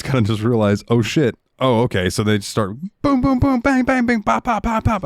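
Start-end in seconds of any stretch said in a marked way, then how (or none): 2.1-5.0 pelt out playful gun noises; kid-style shooting sounds; mock gun noises. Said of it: "making noises".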